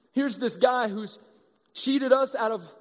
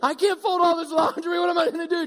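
higher, louder frantic complaint; overwhelmed shout; 7 semitones higher, 3 dB louder than usual. Retold or imitated. Someone drowning in laundry, in a loud frantic shout. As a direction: wail it like you're buried in laundry. Overwhelmed person voiced in joke.

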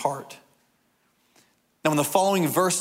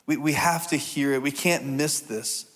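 ordinary talk, nothing marked

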